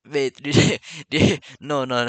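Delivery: ordinary talk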